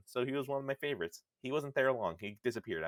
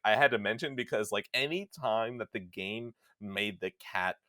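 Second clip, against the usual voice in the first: ordinary delivery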